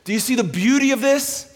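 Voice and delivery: high, urgent sermon delivery; full-force preaching. Real, not acted. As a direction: drive each phrase out to the whole room.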